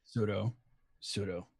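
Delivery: low, strained character summons; Lex. Acted. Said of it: "I can hear your voice going".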